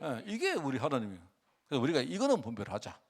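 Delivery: ordinary delivery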